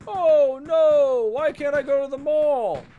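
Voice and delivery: high, loud, whining mockery; overdone high whiny wail; whiny mock complaint. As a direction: whine like a spoiled shopper. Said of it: "they're crying and whining".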